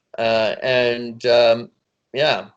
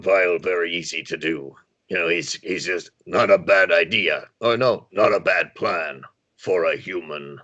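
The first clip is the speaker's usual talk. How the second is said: Vile voice; 7 semitones lower than usual. Shown as a demonstration. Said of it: "it was an easy voice for me to do".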